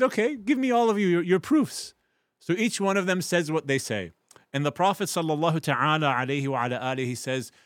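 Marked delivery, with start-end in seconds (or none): 0.0-1.9 higher, louder invitation to argue; inviting judge. Acted. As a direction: call for their proofs, eager. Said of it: "he said".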